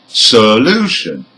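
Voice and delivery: "solution" stressed on LU, weak ending; penultimate stress. Shown as a demonstration. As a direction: hit the middle syllable of "solution". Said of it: "second syllable from the end".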